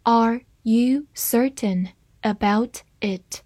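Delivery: one word at a time; slow teacher's model with a pause after each word; slow reading. Shown as a demonstration. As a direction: read slowly so learners can follow. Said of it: "慢慢来读".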